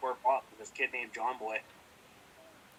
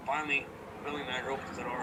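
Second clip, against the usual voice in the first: ordinary talk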